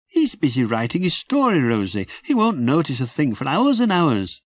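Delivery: put-on high, puzzled little-boy voice; a small child wondering aloud; small boy Jim. Little Jim asks his sister why it's dark, high boy's voice. Jim's voice.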